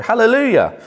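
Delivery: high, loud, joyful hallelujah; enthusiastic hallelujah. Retold or imitated. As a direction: cry it out with delight. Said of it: "slightly more enthusiastic than the British".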